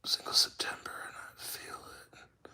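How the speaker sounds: whispered read-through; whispering. Real, not acted. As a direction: whisper the words to yourself. Reading chat under his breath.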